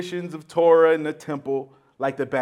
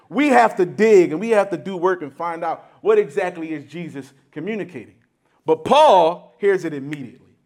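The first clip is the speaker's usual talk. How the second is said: ordinary delivery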